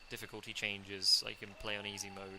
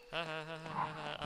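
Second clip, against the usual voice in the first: higher run of separate ha-has; mock evil laugh; 5 semitones higher than usual. Player taunting the zombie dogs, mock evil laugh. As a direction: cackle in a steady string, pitch lifted.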